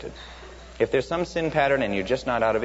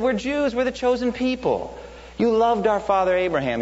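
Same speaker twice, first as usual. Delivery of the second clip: high, smug, self-assured boast; smug Pharisee; 9 semitones higher than usual. Pretend. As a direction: play a proud Pharisee boasting of Abraham.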